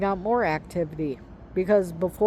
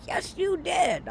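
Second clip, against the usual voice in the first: higher, raised insistence; mock shout; 11 semitones higher than usual. Playing her husband insisting she spoke, a mock shout. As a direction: throw the accusation right back.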